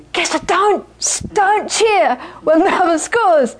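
acted exasperated warning; urgent scolding. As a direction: plead with him to stop cheering. Students correcting the Geshe.

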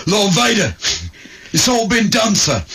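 comic sergeant yelling news to Vader; shouting English sergeant. Retold or imitated. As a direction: bellow the report up at your lord. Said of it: "English sergeants".

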